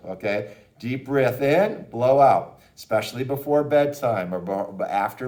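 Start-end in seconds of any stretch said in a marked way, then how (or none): 0.8-2.6 slow, with a loud blow on out; slow breathing demo. Shown as a demonstration. Breathing coach showing a parent, slow and drawn out. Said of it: "do this with your child".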